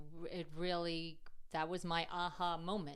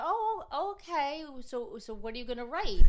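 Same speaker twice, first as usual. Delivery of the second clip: high, loud bright "oh, okay"; forced cheerful surprise; 8 semitones higher, 10 dB louder than usual. Retold or imitated. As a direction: fake brightness over dread. Mom hiding dread, forced cheer.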